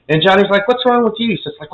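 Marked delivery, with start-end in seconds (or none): 0.7-1.3 higher-pitched acted question; puzzled question. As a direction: ask it like a baffled relative, at normal volume. Johnny questions agitated Stefano.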